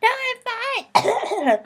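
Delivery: hoarse then squeaky high "I'm fine"; croaky fake-fine. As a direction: pretend to be fine between coughs. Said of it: "I'm going".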